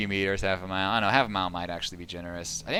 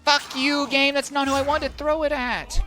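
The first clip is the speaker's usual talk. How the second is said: high, loud curse at the game; angry yell; 5 dB louder than usual. Real, not acted. Gamer cursing the game for a wrong throw, angry yell. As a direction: snap at the game in real anger.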